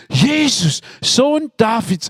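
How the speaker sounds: mild acted shout; mock cry out. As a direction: call out to Jesus from the roadside. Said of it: "da schreit er".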